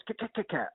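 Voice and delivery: high, clicky vocal bongo pattern; quick high bongo taps, voiced; imitated bongos. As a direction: mimic bongo hits with the mouth. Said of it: "dark shades on playing bongos".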